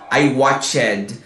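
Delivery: "watched" as two syllables, WATCH-ED; wrong -ed ending. Shown as a demonstration. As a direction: mispronounce "watched" with a full "ed" syllable. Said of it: "a gente nunca vai falar".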